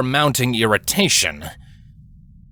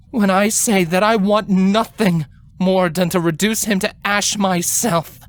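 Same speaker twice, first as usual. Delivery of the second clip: a higher, measured voice of a grave royal sister; grave elder queen; 11 semitones higher than usual. A narrator's reading. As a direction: speak as the older sister calming her gently but firmly. Celestia admits shared anger.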